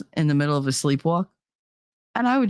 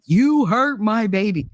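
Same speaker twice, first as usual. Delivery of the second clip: dad rage; 5 semitones higher, 3 dB louder, slower than usual. Retold or imitated. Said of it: "that dad rage".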